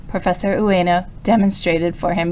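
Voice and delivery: plain reading voice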